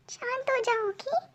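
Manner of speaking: calm her gently under the breath; hushed. Acted. Chef soothes the girl again, hushed reassurance.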